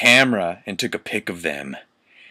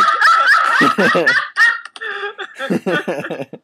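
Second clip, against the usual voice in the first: shrieking laugh, much higher; 6 dB louder than usual. Real, not acted. Cracking up at the fanfic, shrieking laugh. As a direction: burst into a high cackle.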